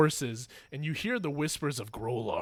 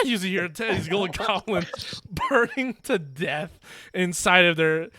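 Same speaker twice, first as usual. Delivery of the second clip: ordinary talk, nothing marked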